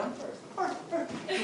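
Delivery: high, giggling mock race; playful laughing. Pushing his girlfriend playfully, laughing to be first. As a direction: shove ahead giggling like a kid.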